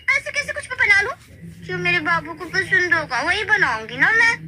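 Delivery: high, sweet fussing insistence; doting wife. Acted. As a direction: fuss over him lovingly. (पत्नी की ऊँची लाड़ भरी ज़िद)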